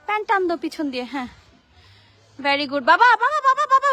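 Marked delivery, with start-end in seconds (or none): 2.8-3.9 higher, louder calls; urgent calling. Real, not acted. Mother calling her son down from the climb, urgent and higher. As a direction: grab his attention quickly.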